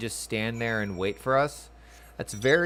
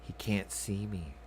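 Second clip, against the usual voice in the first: slow, much softer sneaking line; sneaky hushed; 3 semitones lower, 8 dB quieter than usual. Pretend. Sneaking past unseen.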